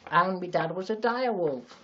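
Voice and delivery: hammed-up fantasy backstory; mock epic tale. Joking she was born in Westeros.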